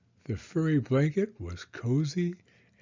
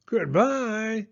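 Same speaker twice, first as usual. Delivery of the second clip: higher mama bear farewell; middle-sized voice; 9 semitones higher than usual. Acted. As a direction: call goodbye as Mama Bear. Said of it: "a middle-sized voice".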